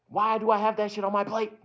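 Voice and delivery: loud, high-pitched protest; indignant, full-volume outburst; exasperated shout. Acted. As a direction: yell the question in disbelief. Outburst at own mental plate.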